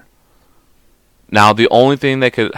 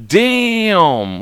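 high, long drawn-out reaction; hammed-up taken-aback exclamation; mock astonishment; 9 semitones higher than usual. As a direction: stretch it out as if stunned. Acting own stunned reaction.

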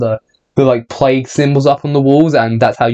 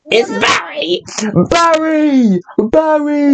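very high mock call; silly character voice; 12 semitones higher, much slower than usual. Friend teasing about Barry in a very high silly voice.

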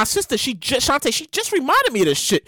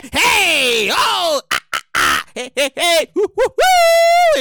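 party whooping; 2 semitones higher, slower than usual. Retold or imitated. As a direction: imitate a reveler cheering on the dance floor. Imitating partying in twenties.

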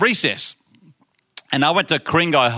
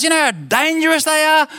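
much higher, louder put-on principal voice; stern principal; 3 dB louder than usual. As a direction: play the principal scolding the boy. Principal scolds the boy.

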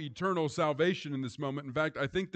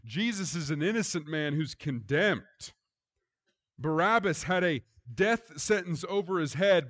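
loud, higher, building refrain on instead of me; preaching at full force; 5 semitones higher, 5 dB louder than usual. Acted. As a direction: drive the refrain home like a climax. Preaching contrast.

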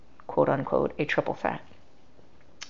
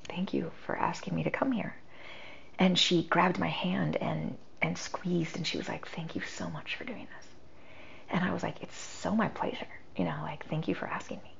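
hushed whisper; 14 dB quieter than usual. Retold or imitated. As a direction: whisper it softly at the bedside. Whispered retelling of deathbed scene.

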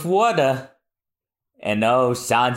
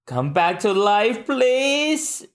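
pleading; 7 semitones higher, much slower than usual. Acted. Begging Luffy to wake.

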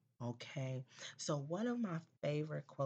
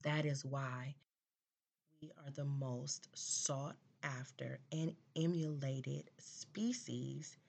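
ordinary talk, nothing marked

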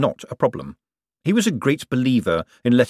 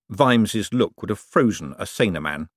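ordinary delivery, nothing marked

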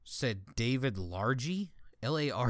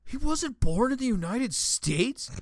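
much higher, slow mock astonishment; shocked question; 12 semitones higher, 4 dB louder than usual. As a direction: play the clueless guy's genuine shock. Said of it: "He goes".

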